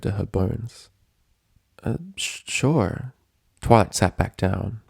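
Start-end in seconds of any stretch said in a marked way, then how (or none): 1.8-3.1 nervous stammer. A narrator's reading. Twilight stammering in dread.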